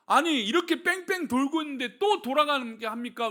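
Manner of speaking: ordinary talk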